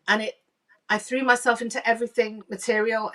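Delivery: ordinary talk, nothing marked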